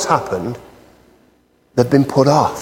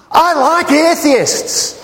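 mock sweet; 11 semitones higher, much slower than usual.

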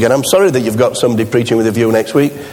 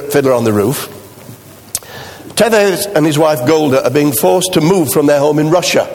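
ordinary talk

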